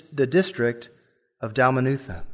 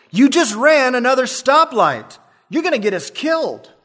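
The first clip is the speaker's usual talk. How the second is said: terrified yell; 9 semitones higher, 3 dB louder than usual. Retold or imitated. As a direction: panic at the driver's recklessness. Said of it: "the passenger yelled".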